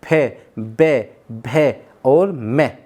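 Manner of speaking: "फे, बे, भे और मैं" drawn out with closed lips; teacher showing lip consonants; slow lip sounds. Shown as a demonstration. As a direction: press the lips on "फे बे भे मैं", slowly. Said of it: "लेकिन जैसे ही हम बोलेंगे पे".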